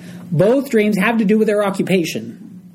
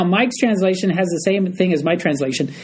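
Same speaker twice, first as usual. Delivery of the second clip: ordinary talk, nothing marked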